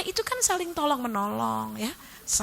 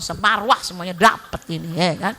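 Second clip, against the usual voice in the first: usual speaking voice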